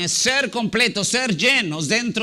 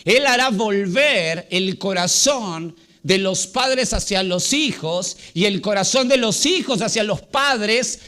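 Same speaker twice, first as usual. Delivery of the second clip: ordinary talk, nothing marked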